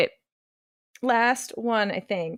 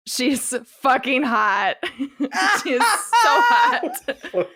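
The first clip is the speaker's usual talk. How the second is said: laughing; 5 semitones higher, 5 dB louder, slower than usual. Real, not acted. Defending her pick.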